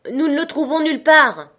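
high, loud call back; shouted reply. A narrator's reading. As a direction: shout the answer down to the road.

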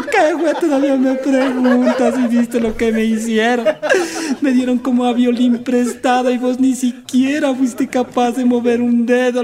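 voz aguda y fuerte, reproche lento; échale en cara su cobardía, chillando; reclamo indignado. Acted. Paisana reclama al novio. (la paisana regaña al novio, aguda y fuerte)

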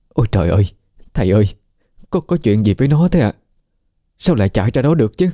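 high, frantic, stammering cry; a frightened mother wailing near tears; panicked near tears. A narrator's reading. Mother panics over her daughter, high frantic near-tearful cry. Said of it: "mặt như sắp khóc mà kêu lên".

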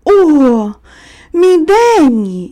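high, drawn-out cry of recognition; sudden exclamation. A narrator's reading. Little boy suddenly spots his friend at the window, exclaiming. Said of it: "раптом вигукнув".

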